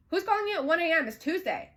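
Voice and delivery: high, loud demand that drops at the end; retold indignant challenge; indignant questioning. Woman challenging him about a call at one AM, high and indignant.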